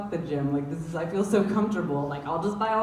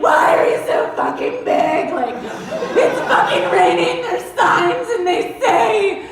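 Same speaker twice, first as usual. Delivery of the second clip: high, loud panicked yelling at trucks; phobic panic; 13 semitones higher, 9 dB louder than usual. Retold or imitated. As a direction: scream it in laughing panic. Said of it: "I'm like".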